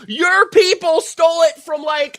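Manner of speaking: high, loud mocking yell; mocking shout. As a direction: throw her words back, shrieking. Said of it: "mocking the protester's words".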